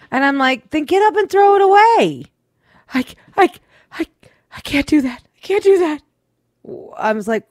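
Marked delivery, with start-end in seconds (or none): 0.7-2.2 much higher, louder mock exasperation; fed up, just deal with it; exasperated. Husband scolding his frightened wife, exasperated. 2.9-6.0 much higher, stuttering panicky voice; too scared to even say it; mock frightened stammer. Wife refusing to touch the mouse, frightened stammer.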